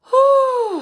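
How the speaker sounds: a big loud whoosh of breath; loud exhale. Shown as a demonstration. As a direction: blow the breath out hard. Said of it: "breathe in".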